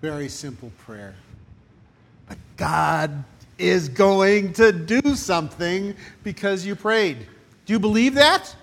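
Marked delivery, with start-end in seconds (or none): 2.3-8.5 preaching. Real, not acted. Preacher rousing congregation.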